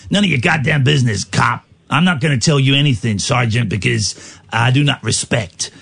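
defiant American mobster put-on; gangster. Gangster telling the sergeant he awaits his attorney, mock defiance. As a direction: play a mob suspect refusing to cooperate. Plays gangster refusing police.

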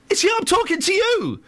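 high, strained outburst of disbelief; cracking high frustrated cry; exasperated. Retold or imitated. Exasperated at fake voice.

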